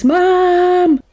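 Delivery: put-on child calling mom. Retelling the film, a high mock child's call for mum. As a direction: call out like a small boy waking his mother. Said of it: "you hear this".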